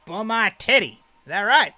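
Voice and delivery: high, loud mocking challenge; mocking showman. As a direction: call out the nickname with glee. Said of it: "He's like".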